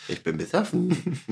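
high, soft, slow tipsy voice; drunk voice. Pretend. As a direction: slur it like you're tipsy. Playing drunk in surprise episode.

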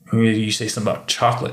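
ordinary delivery

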